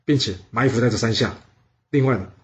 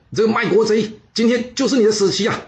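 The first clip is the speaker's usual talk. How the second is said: high-pitched furious rebuke at a traitor; angry cursing; 8 semitones higher than usual. A narrator's reading. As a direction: scold the enemy like an enraged old general. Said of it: "他大骂这乌胡庸说".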